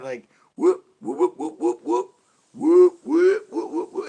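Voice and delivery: loud high choppy siren bursts; siren whoop. Retold or imitated. Rejecting the cops' choppy siren, loud whoops. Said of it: "Don't give me that".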